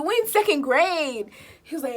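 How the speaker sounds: usual speaking voice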